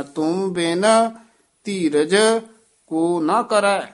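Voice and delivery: teacher showing where to pause in ਤੁਮ ਬਿਨ ਧੀਰਜ ਕੋ ਨਾ ਕਰੈ; short pauses between phrases. Shown as a demonstration. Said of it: "ਤੁਮ ਬਿਨ ਤੇ ਵੀ ਥੋੜ੍ਹਾ ਜਿਹਾ ਠਹਿਰਾ".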